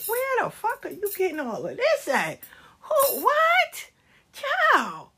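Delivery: high-pitched, laughing disbelief; mock-baffled high squeal; incredulous. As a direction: squeal it like you can't believe what you heard. Mock disbelief at imagined scenario.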